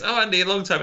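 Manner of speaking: cheery colleague catching herself; mock coworker hello; chirpy coworker. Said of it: "one lady I work with goes".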